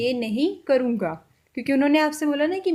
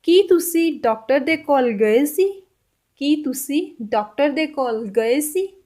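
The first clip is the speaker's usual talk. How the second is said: slow Punjabi demo; 3 dB louder, slower than usual. Shown as a demonstration. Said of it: "Punjabi में कैसे बोल सकते हैं?".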